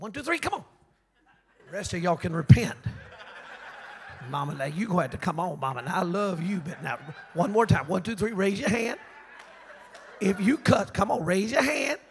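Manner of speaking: loud, chuckling preacher calling out; rally the congregation with a grin; laughing altar call. Acted. Calling the church to raise hands.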